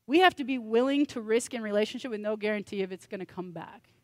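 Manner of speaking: ordinary delivery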